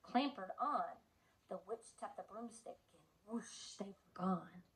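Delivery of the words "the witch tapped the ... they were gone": unvoiced hushed whoosh; whispered whoosh. A narrator's reading. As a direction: breathe out the whoosh. Narrating the broom taking off.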